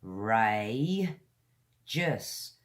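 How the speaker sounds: "Ray", pause, "just", each syllable isolated; teacher breaking up "Ray, just" for learners. Shown as a demonstration. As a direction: sound out "Ray ... just" as separate, stretched syllables. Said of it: "It's got three syllables".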